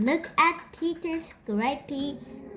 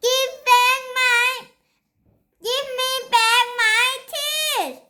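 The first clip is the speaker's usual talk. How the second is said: very high, much louder tearful scream; 13 semitones higher, 6 dB louder than usual. A narrator's reading. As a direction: scream through tears for the tooth. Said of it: "screamed Peter".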